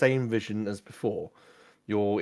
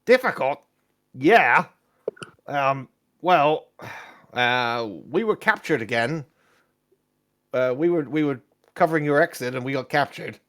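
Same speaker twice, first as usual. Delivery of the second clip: higher, louder, hesitant character voice; eager but awkward companion; excitable adventurer Kezik; 5 semitones higher, 6 dB louder than usual. Acted. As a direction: report the mishap haltingly, pitched up. Game master plays Kezik.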